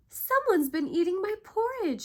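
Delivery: high, loud shriek; shrieking Mama Bear. A narrator's reading. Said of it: "she shrieked".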